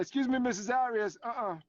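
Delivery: playful "uh-uh" scolding; teacher calling out. Retold or imitated. Teacher calling out the half-dressed wife on camera, sassy. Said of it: "She's like".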